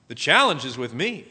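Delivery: normal talking voice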